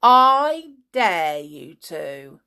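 loud, very slow challenge; drawn-out, forceful goading; shouted dare. A narrator's reading. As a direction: stretch out the dare provokingly. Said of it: "he shouted".

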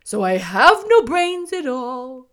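loud, slow straw-man lament; sad scarecrow. A narrator's reading. Scarecrow admitting he has no brains, sad. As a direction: confess it plaintively, drawn out. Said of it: "he answered sadly".

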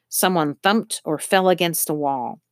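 usual speaking voice

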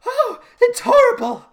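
high, slow, hammed-up flustered shriek; flustered Cynthia. Cynthia bursting in, hammed-up flustered alarm. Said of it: "she's, like, all flustered".

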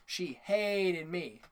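"hated" stretched very long; very slow, leaning hard on "hated"; emphatic complaint. Real, not acted. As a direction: drag out "hated" with heavy emphasis.

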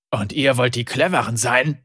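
a low, mild growl from the guard leader; growled taunt. A narrator's reading. Guard leader sneers at the captives, lightly growled. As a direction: sneer it down at the prisoners. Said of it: "knurrte er".

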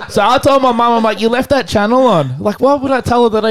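high, laughing retelling; giggling tattletale. Real, not acted. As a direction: laugh through the confession. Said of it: "laughing retelling".

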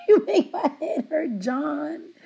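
laughing through the line; teasing laugh on the words. Real, not acted. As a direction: giggle it out, half laughing. Laughing repeat of catchphrase.

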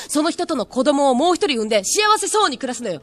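loud insistent idol logic; bright pushy conviction; sweet eerily obsessive voice. Acted.

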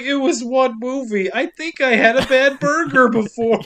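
put-on high pleading little boy; whiny kid voice. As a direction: whine it like a guilty kid. The kid pleads to his parents.

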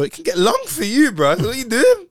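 acted challenge, pitched high and loud; heated retold warning. Retold confrontation with the man.

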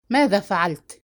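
understated angry question; mild exasperated anger. A narrator's reading. Father interrogates his accused son, mildly furious. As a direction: question him with contained fury. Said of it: "وسأله بحنق".

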